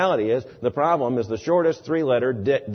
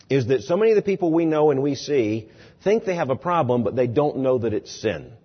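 normal talking voice

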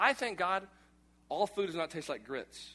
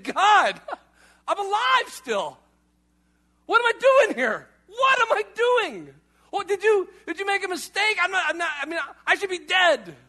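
much higher and louder, disbelieving cries with a laugh; stunned to be alive; 12 semitones higher, 11 dB louder than usual. Acted. Mock waking prayer to God, shocked to still be alive, much higher and louder. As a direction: wake up astonished and blurt it to God.

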